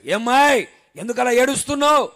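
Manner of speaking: husband's loud anxious question; concerned husband. Acted. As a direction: call out to his crying wife. Husband asks crying wife.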